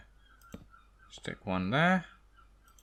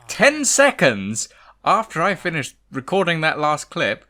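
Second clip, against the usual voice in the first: high, loud, thrilled announcing; excited announcement; 6 semitones higher, 9 dB louder than usual. Real, not acted. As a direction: proclaim the news with delight. Excited announcement of good news.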